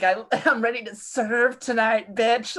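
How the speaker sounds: lightly voiced, sassy and high; sassy coworker. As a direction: hype yourself up for the shift. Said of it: "He's like".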